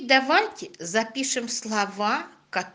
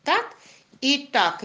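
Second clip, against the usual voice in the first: usual speaking voice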